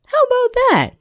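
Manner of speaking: put-on very high delighted kids' voice; children's cheer. A narrator's reading. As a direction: squeal it like happy children. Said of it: "The children all said".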